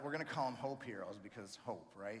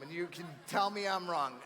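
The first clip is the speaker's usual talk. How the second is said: ordinary talk, nothing marked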